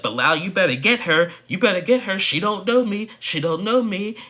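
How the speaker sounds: high, loud imitated screaming match; retold screaming. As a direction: yell it like she's losing it at the table. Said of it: "starts screaming and hollering at her".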